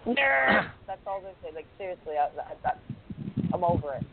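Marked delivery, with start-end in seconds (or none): 0.1-0.7 loud, high jeer; mock heckle. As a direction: shout it as a teasing jeer. Heckles the wrestling fan talk.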